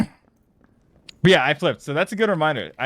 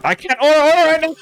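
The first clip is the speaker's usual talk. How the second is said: very high, loud panic; panicked; 8 dB louder, faster than usual. Real, not acted. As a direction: squeal it in a panic. Panicked in game.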